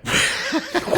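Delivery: squeaky breathy giggle; high giggle. Real, not acted. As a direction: let out a high wheezy giggle. Odd high laugh.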